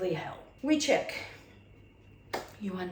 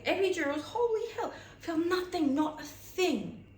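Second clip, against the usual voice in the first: giddy rave; 9 semitones higher than usual. Real, not acted. Raving about the epidural.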